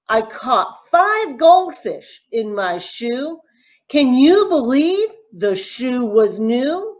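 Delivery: ordinary delivery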